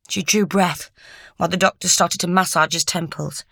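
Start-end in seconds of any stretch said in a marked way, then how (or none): none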